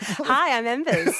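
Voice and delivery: teasing imitation, through a laugh; mock his cheesy intro with a chuckle; laughing mock pickup line. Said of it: "is that your biggest pickup line".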